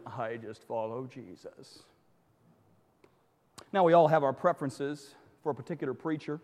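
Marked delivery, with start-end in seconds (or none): 0.1-1.8 slow, low, soft superiority; super-spiritual condescension, drawn out; hushed, dismissive piety; derisive, dismissive. Acted. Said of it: "derisively and dismissively tut-tut".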